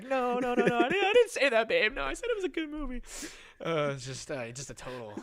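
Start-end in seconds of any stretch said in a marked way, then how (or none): 0.0-3.0 high, loud protesting; frantic mock denial. Boyfriend denying it to his girlfriend, high frantic protest. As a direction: plead innocence loudly at a raised pitch.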